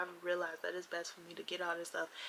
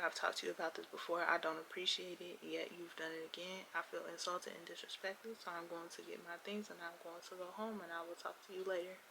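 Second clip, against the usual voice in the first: soft, restrained retelling of standing firm; retold not yelling; 9 dB quieter than usual. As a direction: keep it quiet and controlled, no yelling. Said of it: "I'm not yelling at you".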